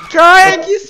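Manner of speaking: high, loud startled cry; shocked yelp. Real, not acted. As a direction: gasp it out in shock. Shocked at sudden scare.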